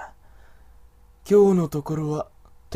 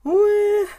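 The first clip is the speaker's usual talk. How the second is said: leering exclamation; 7 dB louder than usual. Acted. Wolf man reacts on seeing her.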